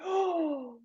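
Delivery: high, soft drawn-out oh; mock shocked gasp. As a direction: gasp and coo in pretend shock. Acts out shocked reaction to rant.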